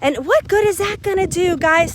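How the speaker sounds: high, shrill-free mock tantrum, pitched well up; high-pitched mock outrage at the company; mock angry rant. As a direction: play the upset customer, hammed-up. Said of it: "get all upset and angry and be like".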